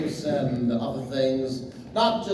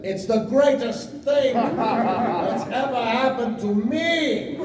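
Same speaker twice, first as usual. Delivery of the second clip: bigger retake; 9 semitones higher, 4 dB louder, slower than usual. Shown as a demonstration. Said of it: "Sorry, let me try that again".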